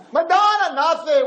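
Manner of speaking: high, loud frantic cry for help; desperate scream. Retold or imitated. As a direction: shriek at the idol to save you. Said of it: "started to scream the name".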